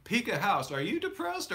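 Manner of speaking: ordinary delivery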